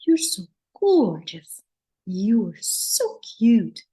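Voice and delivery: drawn-out, sing-song GORGEOUS and CUTE; melodic voice. Shown as a demonstration. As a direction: stretch "gorgeous" and "cute" slowly into a swooping melody. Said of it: "You see the melody?".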